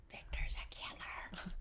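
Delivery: slow conspiratorial whisper. Real, not acted. Joking to listeners about the producer, slow whisper. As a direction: whisper it like a secret.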